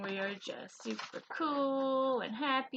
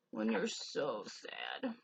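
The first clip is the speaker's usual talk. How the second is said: much lower, slow, mopey sad voice; mock sad; 12 semitones lower, 5 dB quieter, much slower than usual. Teacher acting sad for the kids, low and slow. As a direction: sink the words down low and slow like a sad child.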